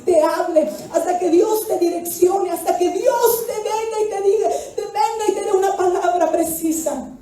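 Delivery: high, impassioned preaching build; full-force Pentecostal preaching; fiery preaching. Acted. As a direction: preach it with rising fire.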